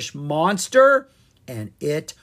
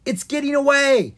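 much higher, louder panicked cry; 12 semitones higher, 9 dB louder than usual. Acted. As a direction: blurt it in alarm. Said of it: "Ella panicked".

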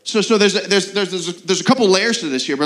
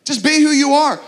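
careless dismissal; 7 semitones higher, 4 dB louder than usual. Retold or imitated. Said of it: "the most cruel thing our Savior could do is say".

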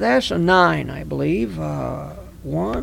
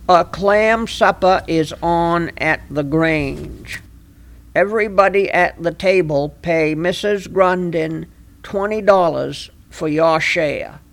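reading the card aloud. Shown as a demonstration. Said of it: "Says here".

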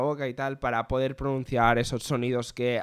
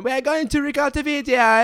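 high, loud mock Australian English; Australian youtuber accent; 12 semitones higher, 6 dB louder than usual. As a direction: imitate an upbeat Australian youtuber, much higher and louder. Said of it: "y es en plan".

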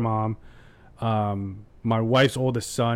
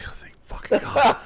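high, breathy laughing thank God; relieved parents, half laughing; mock relief; 11 semitones higher than usual. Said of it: "we're like".

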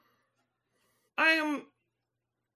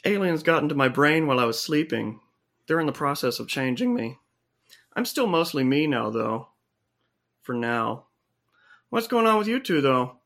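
much lower dude voice; alien-changed guy; 10 semitones lower than usual. Acted. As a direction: drop way down into a laid-back bro. New character voice after alien story.

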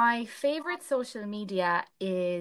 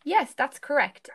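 enthusiastic; 7 semitones higher, 5 dB louder than usual. Acted. Teacher praising answer.

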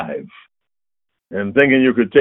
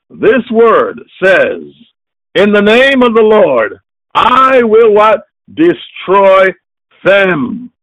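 higher, louder, slow thundering verse; preaching at full force; 6 semitones higher, 7 dB louder, slower than usual. Acted. Preacher thundering the psalm at his congregation, full force. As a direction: hammer each word home.

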